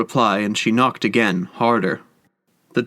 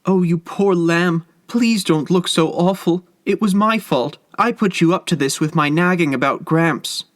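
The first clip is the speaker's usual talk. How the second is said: high-pitched, distressed tenderness; acted tearful self-blame, pitched high; tearful sympathy; 7 semitones higher than usual. A narrator's reading. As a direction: plead with pity in a much higher voice. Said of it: "Tears streamed over her cheeks".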